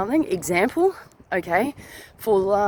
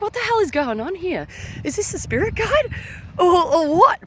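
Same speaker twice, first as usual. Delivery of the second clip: higher, rising alarmed questions; higher, panicky wondering to herself; alarmed questioning; 9 semitones higher than usual. Retold or imitated. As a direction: ask in rising alarm what's going on.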